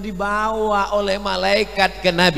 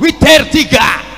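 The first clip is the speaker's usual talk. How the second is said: high, loud spoken shout; curt shouted prayer notice; imam's blunt announcement; 4 semitones higher, 5 dB louder than usual. Shown as a demonstration. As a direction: call it out abruptly without melody. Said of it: "atau imamnya ngomong".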